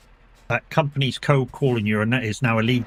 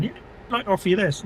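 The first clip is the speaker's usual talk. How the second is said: higher-pitched imitation sales offer; mock telemarketer; 6 semitones higher, 3 dB quieter than usual. Company emailing a streamer an offer, mock telemarketer voice. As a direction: put on a telemarketer's pitch, raised voice. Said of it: "kinda like a telemarketer".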